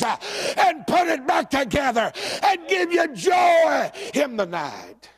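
high, shouted revival preaching, drawn-out phrases; full-force preaching. Real, not acted. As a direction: preach it at the top of your range.

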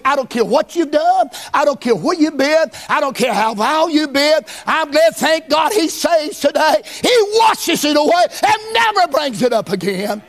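high, shouted whooping sermon; preaching at full force. Acted. Preacher calling out to sinners in the church, shouting high. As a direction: let it ring out like a revival climax.

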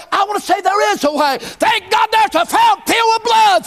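high, shouted whooping sermon; preaching at full force. Acted. Preacher calling out to sinners in the church, shouting high. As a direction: let it ring out like a revival climax.

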